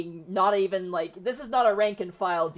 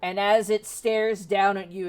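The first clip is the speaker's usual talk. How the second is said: slow, loud game master narration; dramatic scene setting; 3 semitones higher, 7 dB louder, slower than usual. Acted. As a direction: announce the monster's arrival with drawn-out menace.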